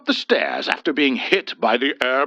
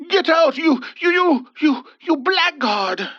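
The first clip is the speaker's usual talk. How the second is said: higher, louder stammering outburst, fading at the end; sputtering shout; 3 dB louder than usual. A narrator's reading. Old man sputtering the punk out of the house, shouting. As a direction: splutter with rage. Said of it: "Hubert cried out".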